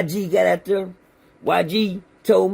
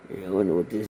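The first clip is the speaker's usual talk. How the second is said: low mutter; 12 semitones lower, 6 dB quieter, faster than usual. Retold or imitated. Imitating side-eye muttering.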